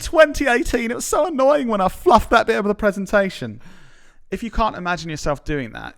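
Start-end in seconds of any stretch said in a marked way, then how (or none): 0.0-3.6 high, loud whiny moaning; mock whiny regret. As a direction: moan it like a petty grudge. Imagined deathbed regret quote.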